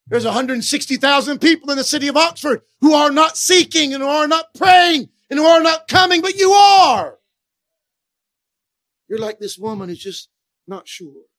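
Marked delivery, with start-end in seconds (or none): 0.1-7.2 rising to a full-voiced shout, loud and high; preaching shout. Real, not acted. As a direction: build and build until you are shouting it out. Preacher building to climax.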